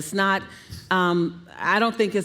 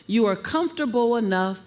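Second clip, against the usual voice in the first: ordinary talk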